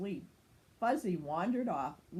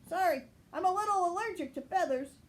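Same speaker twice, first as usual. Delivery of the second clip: much higher, fast, sheepish; Chase's voice; 10 semitones higher, 5 dB louder, faster than usual. Acted. Chase excusing his sneeze, fast and much higher. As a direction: rattle off the apology.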